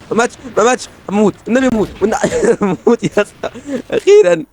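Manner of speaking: high-pitched frantic urging; begging the enemy to die, voice up high; excited. Real, not acted. Excited gamer yelling at enemy. (متحمس بصوت عالي)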